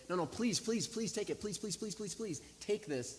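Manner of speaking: high, rapid-fire string of begging pleases; pushy pleading. Retold or imitated. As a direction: imitate someone pushily insisting. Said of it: "he began to get a little pushy".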